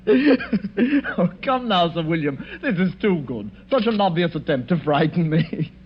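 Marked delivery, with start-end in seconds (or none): 0.1-1.9 laughing scoff. Acted. Villain laughs off ghost story.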